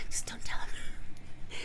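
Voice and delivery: slow conspiratorial whisper. Pretend. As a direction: breathe it like a secret. Secret aside to chat.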